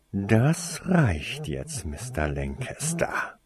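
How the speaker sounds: very slow, controlled order; measured authority, sinking at the end; stern command. A narrator's reading. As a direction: draw out each word to shut them down. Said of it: "befahl Snape".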